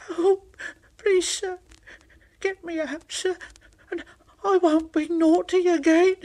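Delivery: high, tearful begging; pleading little engine. Acted. Thomas begging the fat controller, high and tearful. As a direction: beg to be rescued.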